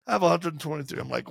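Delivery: usual speaking voice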